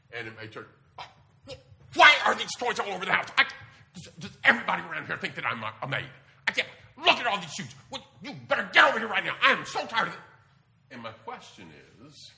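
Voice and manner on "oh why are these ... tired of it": loud, high, fast mom tirade; grating, badgering nagging. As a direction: act out a fed-up mother yelling at her kids. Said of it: "Kind of grating and badgering and nagging".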